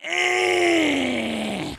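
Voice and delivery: long, loud high "eh"; drawn-out squeal. Real, not acted. Streamer reacting to being six years off, high squeal. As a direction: hold a high strained cry, then let it fade.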